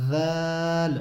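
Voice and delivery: जाल said softly, the tongue tip touching the teeth; soft zaal. Shown as a demonstration. Teacher to viewers, a gentle जाल. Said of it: "धीरे से निकालनी है".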